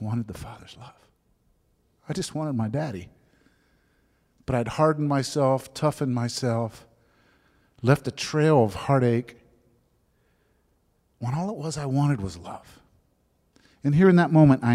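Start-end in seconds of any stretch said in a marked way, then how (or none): none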